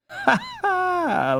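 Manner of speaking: put-on a girl laughing at her fallen brother; girlish laugh. A narrator's reading. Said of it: "laughed Gretchel".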